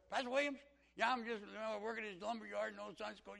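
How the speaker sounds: put-on high, loud country good old boy; hammed-up country man calling out; country accent. Country man speaking up in class, loud country accent. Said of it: "he just talked like this".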